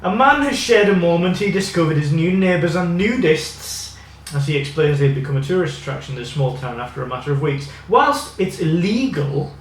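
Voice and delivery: reading an article aloud; news reading. Acted. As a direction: read it out like a news piece.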